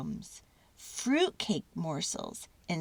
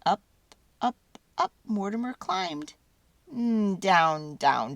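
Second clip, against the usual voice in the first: ordinary delivery, nothing marked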